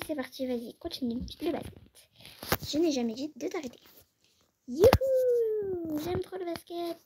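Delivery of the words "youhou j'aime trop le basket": high joyful whoop that falls back; excited kid cheer. Acted. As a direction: cheer like a kid who loves the game. Enfant ravie de jouer au basket. (cri de joie d'enfant, aigu puis retombant)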